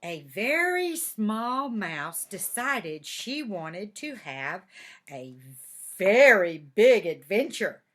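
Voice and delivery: slow, storytelling read-aloud; reading to children. A narrator's reading.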